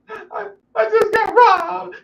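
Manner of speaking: very high, slow sobbing voice; hysterical sister. Retold or imitated. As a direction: play the crying sister gasping it out. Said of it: "called me crying, hysterical".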